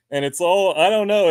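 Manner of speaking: ordinary talk, nothing marked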